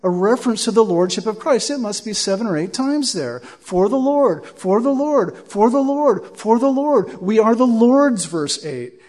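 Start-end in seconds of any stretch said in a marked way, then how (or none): 3.4-8.3 each "for the Lord" rising higher and louder, hammering "Lord"; repeated emphasis. Shown as a demonstration. Preacher driving home the refrain to his congregation, building emphasis. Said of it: "did you notice there how many times he said it".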